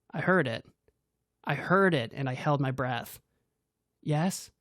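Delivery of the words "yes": ordinary delivery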